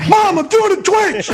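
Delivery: very high yell to mom. Retold or imitated. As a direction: shout it up high like a teen. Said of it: "I could have thrown that voice".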